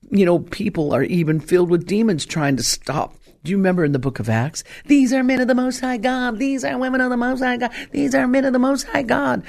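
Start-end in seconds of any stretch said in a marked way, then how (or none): 4.9-9.4 put-on high, strained hollering of the possessed girl. A possessed girl shouts at the apostles in a high, strained voice. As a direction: lift the voice way up like a girl crying out in the revival. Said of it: "screaming and hollering".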